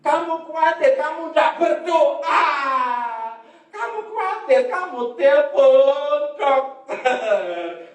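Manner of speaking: high, loud, drawn-out reproach; chiding preacher. Real, not acted. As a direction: cry it out from the pulpit, last words stretched. Preacher chides worriers at full force.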